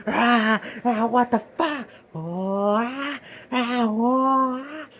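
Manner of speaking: hammed-up panicked cries, high; high, slow mock-frightened yelps. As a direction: yelp in mock terror as something grabs him. Character panics as hand grabs.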